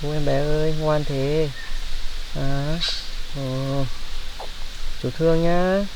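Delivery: put-on soft, hushed cooing to a baby; calm a crying infant gently, slowing down at the end; soothing baby talk. Man soothing a found baby.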